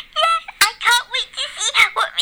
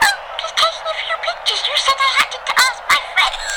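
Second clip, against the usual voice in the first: high wife voice; 7 semitones higher than usual. Acted. Lacey taking pictures.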